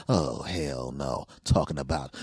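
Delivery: ordinary talk, nothing marked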